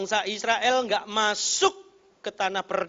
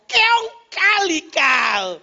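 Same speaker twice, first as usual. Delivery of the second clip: high, loud, drawn-out mock insult; imitated angry yell; 10 semitones higher, 11 dB louder, much slower than usual. Driver still not satisfied yells at the rickshaw, mock furious shout. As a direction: bellow it slowly like an outraged driver. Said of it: "Masih belum puas dia teriak".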